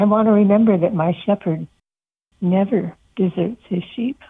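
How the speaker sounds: usual speaking voice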